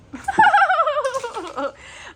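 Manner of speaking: high-pitched burst of laughter; big high laugh; loud laugh. Real, not acted. Laughing at the child's demands.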